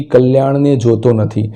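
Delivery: usual speaking voice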